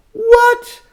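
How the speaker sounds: high, loud shocked what. Acted. Storyteller reacting in shock for the children. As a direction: gasp out a surprised what.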